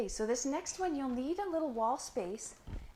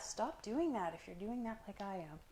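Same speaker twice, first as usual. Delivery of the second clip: ordinary talk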